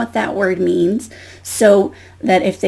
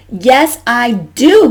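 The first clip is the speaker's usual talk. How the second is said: loud, higher protest from Goose; crying out; 4 semitones higher, 6 dB louder, much slower than usual. Acted. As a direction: cry back defiantly as a storybook goose. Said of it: "Goose cried".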